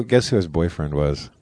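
usual speaking voice

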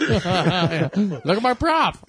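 slow drawn-out laughing toy voice; pull string toy. Retold or imitated. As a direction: stretch it like a winding-down doll. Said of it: "What does he say when you pull the string".

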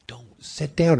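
urgent whispered warning. Retold or imitated. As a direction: whisper it sharply, embarrassed. Girlfriend hissing at boyfriend.

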